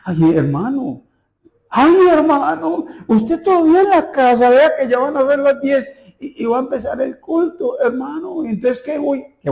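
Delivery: put-on a nervous young pastor begging on the phone; high-pitched mock panic, voice cracking with worry; panicked pleading. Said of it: "lo llamó temblando".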